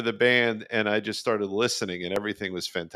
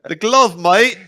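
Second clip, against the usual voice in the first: a loud, higher attempt at a Scouse accent; mock Scouse; 9 semitones higher, 12 dB louder, slower than usual. Co-host doing his Liverpool bit, loud mock Scouse. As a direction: ham up a Scouse accent for a laugh. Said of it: "There's my bit".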